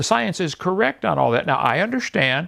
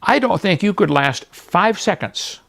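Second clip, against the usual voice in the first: ordinary talk, nothing marked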